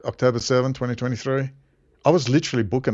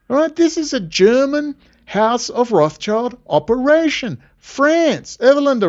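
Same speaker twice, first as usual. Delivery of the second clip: fired-up rant; 7 semitones higher than usual. Real, not acted. Heated high rant.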